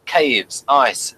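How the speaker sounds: ordinary delivery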